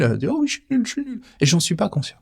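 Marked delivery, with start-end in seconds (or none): 0.3-1.2 mocking inner voice. Inner voice agreeing, mocked.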